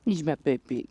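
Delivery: soft doting pet voice. Real, not acted. Cuddling her cat, soft doting baby talk. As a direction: dote on him softly.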